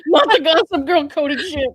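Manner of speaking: words through laughter; cracking up; laughing. Real, not acted. Laughing at Gossip Girl.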